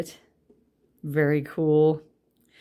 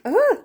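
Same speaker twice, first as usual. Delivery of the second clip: startled cry; 7 dB louder than usual. Real, not acted. Card suddenly falls out.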